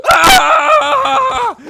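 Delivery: very high, loud shriek; imitated terrified scream. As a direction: shriek like startled friends. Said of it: "and they screamed".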